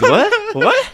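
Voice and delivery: mock shocked squeal, way up high; shrieking what. Friends mocking the anime scene, shrieked high. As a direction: squeak it out in cartoon disbelief. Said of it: "It's like".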